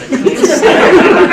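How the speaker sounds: breaks into real laughter mid-sentence; laughing at his own picky eating. Real, not acted. Laughs about hated food.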